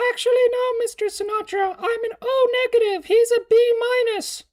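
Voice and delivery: put-on very high, loud squeaky kid voice. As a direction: pipe it up like a know-it-all kid. Mocking reply to the film.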